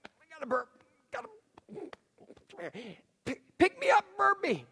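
hammed-up baby whine; whiny baby voice. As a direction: play a needy baby begging to be burped. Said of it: "And I'm like".

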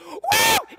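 very high, loud whoop; sports whoop. Shown as a demonstration. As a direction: whoop for your kid's hit. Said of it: "What do you do?".

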